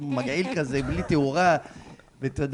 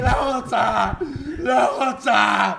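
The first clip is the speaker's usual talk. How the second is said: high, loud, drawn-out wail; hammed-up wailing bride; retold tearful refusal; 8 semitones higher, 7 dB louder, much slower than usual. Imitating the crying bride locked in the bathroom, loud wailing.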